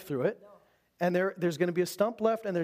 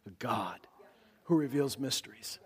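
hushed reverence; 7 semitones lower, 4 dB quieter than usual. Real, not acted. Reverent climax of point.

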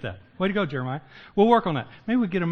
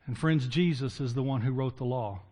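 ordinary talk, nothing marked

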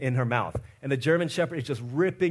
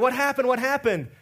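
alarmed asking; 6 semitones higher than usual. Retold or imitated. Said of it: "She's like".

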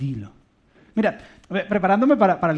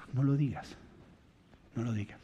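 soft repeated warning; 4 semitones lower, 12 dB quieter than usual. Acted. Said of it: "si lo que vas a decir no edifica".

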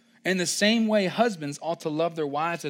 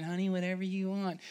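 soft, limp mock husband; lightly voiced doormat husband; meek husband; 3 semitones higher, 7 dB quieter than usual. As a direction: mumble it like a pushover.

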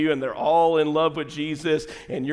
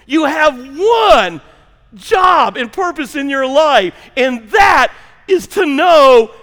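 high, loud, slow sermon delivery; impassioned preacher building to a shouted climax; full-force preaching; 8 semitones higher, 8 dB louder, slower than usual. Acted. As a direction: drive each phrase home to the congregation.

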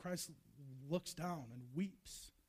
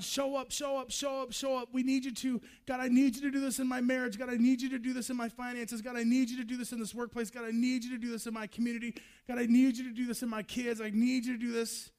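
loud, higher, rapid-fire pleading; acted desperate prayer, fast and raised; urgent begging; 4 semitones higher, 7 dB louder, faster than usual. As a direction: plead insistently, piling up each request. People begging God to act.

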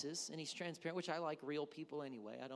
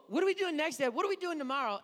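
high, loud imitated child; pestering kid; 9 semitones higher, 8 dB louder, faster than usual. Kids asking dad about the next activity, pestering. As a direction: badger dad like an impatient kid.

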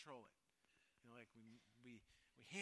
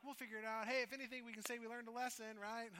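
higher, louder, breezy we'll-be-fine voice; breezy self-talk; 9 semitones higher, 9 dB louder than usual. Retold or imitated. Preacher voicing people talking themselves into it, breezy. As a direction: talk yourself into it with a cheerful shrug.

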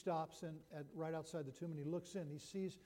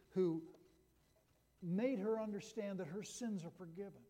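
louder, rising sermon build; impassioned preaching; 3 semitones higher, 4 dB louder than usual. Acted. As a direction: preach it with mounting fervour. Preaching the empty tomb.

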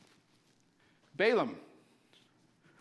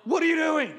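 high furious shout; king yelling at the prophet, furious; 10 semitones higher, 9 dB louder, slower than usual. Acted. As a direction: explode at Balaam in rage. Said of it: "the king's furious".

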